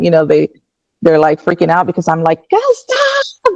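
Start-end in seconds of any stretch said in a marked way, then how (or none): 2.5-3.5 much higher, pleading cry to stop; retold panicked plea from the operating table. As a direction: beg them to stop, voice jumping up. Begging doctors during painful surgery.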